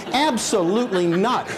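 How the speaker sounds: high, indignant retold refusal; hammed-up adamant no; emphatic mock refusal. Retold refusal of the offered joint.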